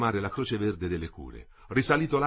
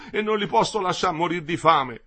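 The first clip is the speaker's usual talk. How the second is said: desperate pleading; 8 semitones higher, 7 dB louder than usual. A narrator's reading. Olindo under the blows begs his torturers, pleading. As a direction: beg for your life. Beaten man begs for mercy.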